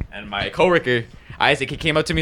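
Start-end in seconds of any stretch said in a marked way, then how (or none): none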